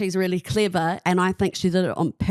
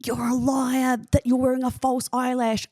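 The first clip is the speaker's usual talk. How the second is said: higher-pitched put-on accusing voice; mock outrage; 5 semitones higher than usual. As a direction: imitate an outraged online mob. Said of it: "everyone went mental going".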